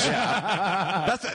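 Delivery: ordinary delivery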